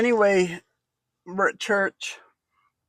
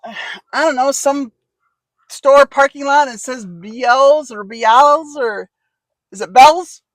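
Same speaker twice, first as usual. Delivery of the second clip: higher, louder, pretend confused caller; acting lost; 5 semitones higher, 5 dB louder, slower than usual. Pretending to Janella she is lost, raised confused voice. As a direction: play up being lost, slowly.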